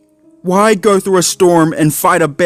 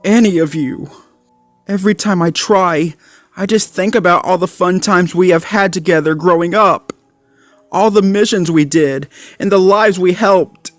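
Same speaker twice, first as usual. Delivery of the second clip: holding back sobs, head lowered; crying mutter; 9 semitones higher than usual. A narrator's reading.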